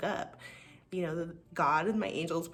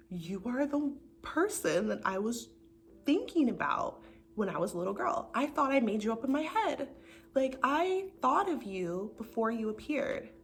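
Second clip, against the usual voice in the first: higher, stretched-out words of amazement; awed marvelling; 5 semitones higher than usual. Retold or imitated. Woman addressing the dream partner she imagined as a girl, awed marvelling.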